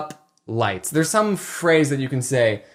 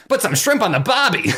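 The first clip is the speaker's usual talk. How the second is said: hammed-up Australian, pitched up; Australian catchphrase accent, higher; 5 semitones higher than usual. Shown as a demonstration. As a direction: do a broad mock Australian accent. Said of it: "prep up my Australian accent".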